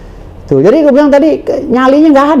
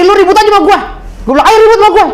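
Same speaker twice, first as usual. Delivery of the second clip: challenging retort; 9 semitones higher, much faster than usual. Retold or imitated. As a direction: dare him to fight, raised voice. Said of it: "Gue tunjuk lagi". (menantang jaksa dengan suara tinggi)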